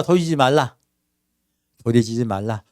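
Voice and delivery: ordinary talk, nothing marked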